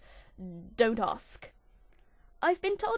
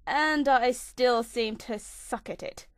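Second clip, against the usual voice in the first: normal talking voice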